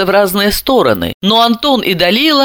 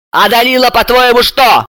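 raised-pitch angry sarcasm; enraged; 6 semitones higher, 3 dB louder than usual. A narrator's reading. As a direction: throw sarcastic questions at your sister. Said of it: "Бессарабову разъярила".

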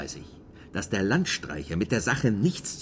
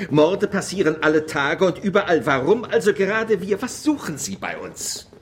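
loud, much higher, indignant shouting; angry outburst; 9 semitones higher, 7 dB louder than usual. Acted. Mayor shouting at the Paris journalist, furious. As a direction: blow up at her in outrage.

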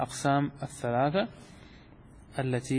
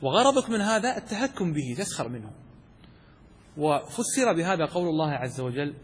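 normal talking voice

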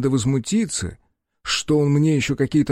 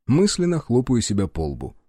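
ordinary delivery, nothing marked

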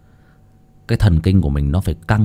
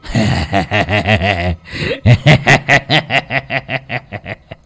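long staccato cackle; mad villain cackle; 3 dB louder than usual. Acted. Lão Tuân gloating over the dying priest, crazed laughter. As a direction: cackle on and on like a madman. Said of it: "Lão Tuân cười man dại".

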